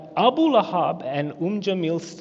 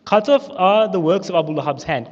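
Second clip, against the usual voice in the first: louder, solemn proclamation of the verse; forceful recitation; 4 semitones higher, 8 dB louder than usual. Shown as a demonstration. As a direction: declaim it like a judgment. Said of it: "And the surah goes like this".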